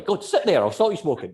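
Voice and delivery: playfully bossy newbie hypnotist; bossy beginner. Retold or imitated. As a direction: order a friend into the chair. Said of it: "And they're like".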